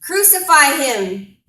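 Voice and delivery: loud, high, drawn-out shout; crowd's scream. Acted. Mob calling for Christ's death in a poem, screaming. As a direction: shout it out as a mob's demand. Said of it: "they're screaming in my face".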